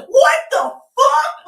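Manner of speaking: slow, very high, loud outraged shriek; shocked shout. Retold or imitated. The women in the audience react, shocked shout. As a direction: scream it in disbelief. Every woman in room reacting.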